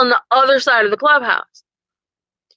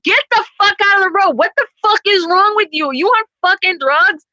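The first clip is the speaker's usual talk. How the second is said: higher-pitched mock yell out the car window; retold yell; 7 semitones higher than usual. Driver yelling at woman standing in the road, a raised mock yell. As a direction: throw it at her like an angry driver, pitched up. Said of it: "I'm, like, yelling at her".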